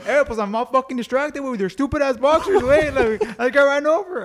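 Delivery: loud, high mock complaint; Javi impression. Injured buddy griping about boxers, high loud impression. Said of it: "this dude actually talks like this".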